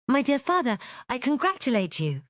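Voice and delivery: high, glad congratulation; joyful. Acted. Jane congratulating her father, joyful and high. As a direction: beam at him with delight.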